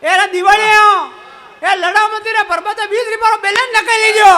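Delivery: loud, rising shouted taunts; thief shouting. Retold or imitated. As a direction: yell at the family from the doorway. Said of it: "माने क्यों".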